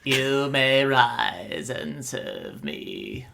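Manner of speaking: slow, commanding dark lord; anime villain voice. Acted. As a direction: draw it out like a shadow monarch. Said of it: "Pretty good, dude".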